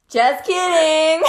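cracking up, high and loud, slowly; laughing hard. Real, not acted. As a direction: talk through a fit of laughter. Bursting out laughing at slip.

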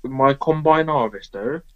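slow farmer-style Bristolian; badly faked Bristol farmer; Bristolian accent. Shown as a demonstration. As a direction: draw out a rough West Country accent. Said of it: "try a Bristolian accent".

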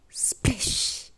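voiceless hushed splash noise; splash sound effect. A narrator's reading. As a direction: imitate a splash with a long rush of breath. Narrator imitates jumping into water.

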